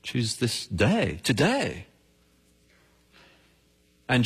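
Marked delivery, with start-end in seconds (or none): 0.0-1.8 solemn slow appeal. Acted.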